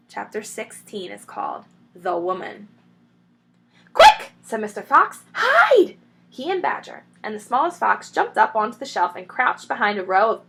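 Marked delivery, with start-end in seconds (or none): 3.9-4.3 sharp, very high, loud alarm; urgent cry. A narrator's reading. Mr Fox warns the others to hide, urgent high cry. 5.3-6.0 sharp, very high, loud alarm; urgent cry. A narrator's reading. Mr Fox warns the others to hide, urgent high cry.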